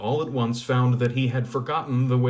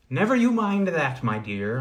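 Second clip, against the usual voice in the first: put-on old woman; 5 semitones higher, 4 dB louder than usual. Old woman reassures blind.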